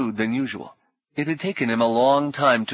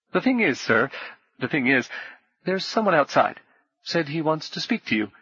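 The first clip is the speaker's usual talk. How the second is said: higher, fretful innkeeper voice, tumbling over his words; a jittery tavern keeper babbling to appease a dangerous guest; nervous innkeeper; 3 semitones higher than usual. Acted. Innkeeper tells the swordsman someone waits outside, higher and jittery.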